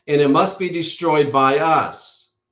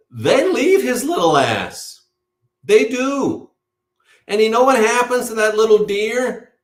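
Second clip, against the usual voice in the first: pitched higher and louder, fervent sermon cadence; preaching at full force; 9 semitones higher, 4 dB louder than usual. Acted. Preacher to his followers about the abandoned fawn, impassioned higher preaching. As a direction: drive it home like a pulpit preacher, slowing on key phrases.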